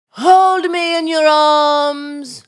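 slow, loud, drawn-out stage plea; milk every word like a tragic heroine; melodramatic plea. Acted. Actress rehearsing her audition lines, overacted. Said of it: "go ahead".

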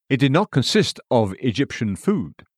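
ordinary delivery

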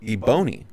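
Ebony said as "ee-BOH-nee"; mispronounced ebony. Retold or imitated. Guest reads the magazine title wrongly, Ebony as "ee-BOH-nee". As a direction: rhyme Ebony with baloney, starting on EE. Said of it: "the quirky dude saying Ebony".

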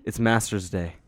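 ordinary talk, nothing marked

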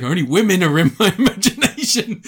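laughing indignant. Retold or imitated. Pervert guarding his dream, laughing mock outrage. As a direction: giggle through an offended protest.

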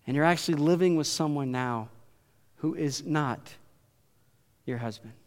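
low, soft, measured retold reply; hushed, deliberate delivery in a low voice; quiet gentle confrontation. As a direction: lay out the truth softly, with pauses. Jesus gently exposes her secret.

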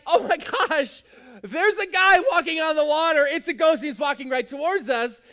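high, loud alarmed exclaiming; mock panic. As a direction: act out terrified disciples, hammed up. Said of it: "the disciples are absolutely panicking".

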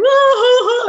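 hammed-up teenage sob; mock crying wail. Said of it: "And I was going".

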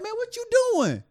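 very high, loud alarmed cry; alarmed bystander. Retold or imitated. Bystander shouting at officer, high alarmed yell. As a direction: cry out in disbelief. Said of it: "He's like".

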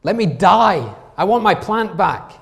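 high, loud petulant wail; whiny Jonah. Acted. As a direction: whine like a sulking child who lost his toy. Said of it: "he's like".

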